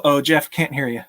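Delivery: usual speaking voice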